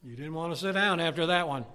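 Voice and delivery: ordinary talk, nothing marked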